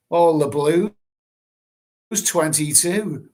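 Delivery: bingo caller. Pretend. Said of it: "what have I got to say to that".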